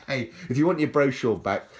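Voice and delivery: mock demand. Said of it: "I'm joking, mate".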